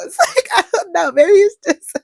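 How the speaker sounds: high, squeaky laughing words; laughing while talking. Real, not acted. Host owning up to getting irate, laughing through it. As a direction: break into laughter as you talk.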